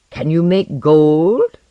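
alchemist grinning as he asks a scientist about gold, slow and loud; grinning question. A narrator's reading. Alchemist probing his visitor about gold, grinning. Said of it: "he grinned as he asked".

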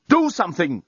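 urgent but contained scream. A narrator's reading. Dan demanding action from the journalist, mildly screaming. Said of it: "screamed Dan".

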